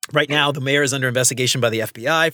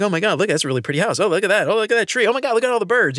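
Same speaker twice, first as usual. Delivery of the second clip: excited; 7 semitones higher, much faster than usual. Retold or imitated.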